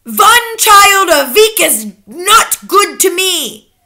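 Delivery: slow, high, loud witch outburst; crying out. A narrator's reading. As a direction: cry it out slowly in outrage. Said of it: "the Grand High Witch cried out".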